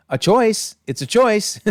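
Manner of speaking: high, pointed, teasing understatement; playful sarcasm about a bad mustache; wry mock tact. Real, not acted. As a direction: raise the pitch and land it as a polite dig. Joking about the singer's mustache.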